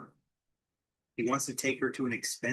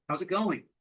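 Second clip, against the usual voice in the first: higher-pitched pretend call-out; mild mock yell; 5 semitones higher than usual. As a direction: quote a yell without full volume. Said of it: "Does he yell".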